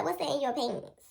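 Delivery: lightly voiced suspicious mother; mother's questioning. Mom questions child about the pants, suspicious.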